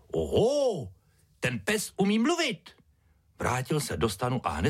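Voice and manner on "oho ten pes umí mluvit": high-pitched amazed ringmaster; excited cry. A narrator's reading. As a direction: exclaim with delight in a high voice. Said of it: "vykřikl nadšeně".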